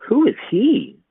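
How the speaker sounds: put-on high curious child; wide-eyed small boy; little boy voice. A narrator's reading. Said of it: "asked Jake".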